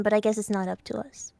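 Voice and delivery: unvoiced whisper; whispered aside. Real, not acted. Whispering a quiet aside to co-host. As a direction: breathe it as a secret aside.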